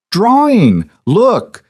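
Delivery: put-on high, eager child voice; little girl. Little girl shows her dad her drawing, high and eager. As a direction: show off a drawing like a small kid.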